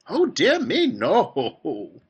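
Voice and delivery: high fluty exclamation dropping into a chuckle; Willy Wonka. A narrator's reading. Factory owner warning against losing children, fluty and fussing. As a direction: pipe it out high, then let it fall. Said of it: "His voice was high and fluty".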